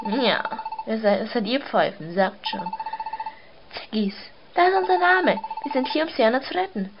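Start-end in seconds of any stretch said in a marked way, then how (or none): 3.7-6.9 heroic announcement. Acted. Voicing a hero announcing rescue.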